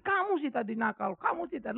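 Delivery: mother's weepy blame, lightly acted; imitated tearful reproach. Crying mother blames her naughty son, imitated tearful reproach. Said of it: "Terus dia nangis lagi".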